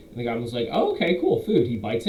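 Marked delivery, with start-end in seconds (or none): 0.7-1.7 high, loud eager goblin squeak; a goblin delighted by a snack, so much higher. Acted. Goblin accepts the druid's fruit in a high eager voice.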